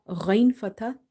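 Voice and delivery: ordinary delivery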